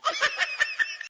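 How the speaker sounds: sudden high burst of laughter. Acted. Mother-in-law laughing with neighbour.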